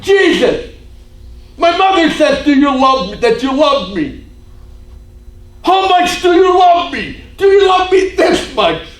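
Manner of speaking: high, loud, desperate demand; retold taunt at full shout. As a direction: shout the questions at him, pressing harder. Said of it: "she started to taunt him".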